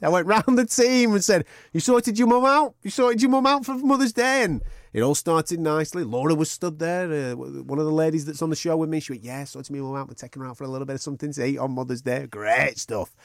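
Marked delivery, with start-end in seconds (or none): 1.7-4.4 chirpy, raised-pitch asking round; cheery team round-up. Retold or imitated. Host asking the team about Mother's Day, cheery. 9.2-12.3 soft, quick, offhand reply; casual colleague's answer. Retold or imitated. Imitating a colleague with Mother's Day plans, casual.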